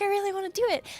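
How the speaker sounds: high-pitched mock pleading; begging. Tank begs the guild for the fun job, high pleading. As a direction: plead like you badly want a turn. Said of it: "I begged my guild".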